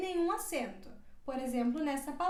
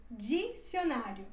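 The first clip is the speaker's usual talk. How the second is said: slow model word; 5 semitones lower, slower than usual. Shown as a demonstration. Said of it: "D com I".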